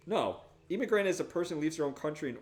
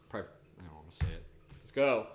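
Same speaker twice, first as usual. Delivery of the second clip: normal talking voice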